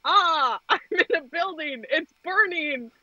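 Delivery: high, hammed-up damsel in distress, half laughing; mock panic. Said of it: "I slid up with".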